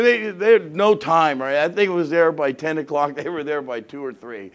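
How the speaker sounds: ordinary talk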